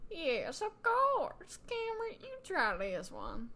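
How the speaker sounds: put-on, high swooping character voice; high start, slowing down at the end; lilting shopkeeper character. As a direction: hand it over with exaggerated sweetness. Reading Sylvie's lines in character.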